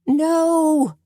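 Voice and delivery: very high shrill no; shrill cry. A narrator's reading. Suzanne seeing the killer escape, shrill high cry. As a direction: shriek it in dismay. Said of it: "Suzanne shrilled".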